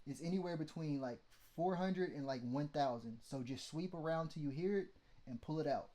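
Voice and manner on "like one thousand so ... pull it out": ordinary talk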